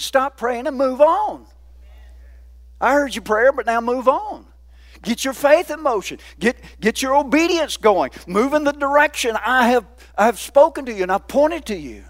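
preacher voicing God to the church at full force; full-force preaching. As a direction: thunder God's orders to the congregation. Preaches God's words at full force.

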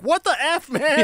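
high, startled mock outburst; retold outburst. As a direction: blurt it out like you can't believe it. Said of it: "and I'm like".

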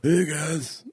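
lightly put-on injured athlete; a hint of a hurting football player; Tony Romo voice. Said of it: "Your Tony Romo voice".

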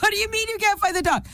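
mother panicking about the lost dog; panicked. Retold or imitated. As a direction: blurt it out in a rising panic.

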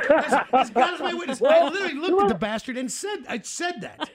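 laughing high and loud; cracking up while telling it. Real, not acted. Laughing disbelief at own story.

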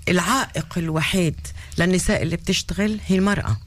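usual speaking voice

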